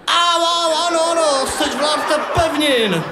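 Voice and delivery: high squealing surrender; whiny boy in pain. Acted. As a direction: yelp and give in fast.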